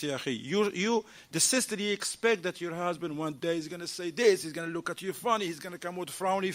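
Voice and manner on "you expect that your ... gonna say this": ordinary delivery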